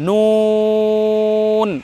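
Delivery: নুন with a very long "oo"; rounded-lip articulation. Shown as a demonstration. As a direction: hold নুন on one level note, lips rounded. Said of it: "নুন উচ্চারণ করার সময় ঠোঁট গোল হবে".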